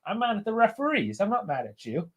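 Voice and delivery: calm her down kindly; quiet, tender reassurance for a pet; reassuring dog. Real, not acted.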